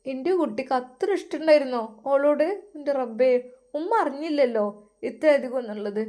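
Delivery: mother laments she never knew he loved her so; grieving mother. Acted. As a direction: cry out to God over her son.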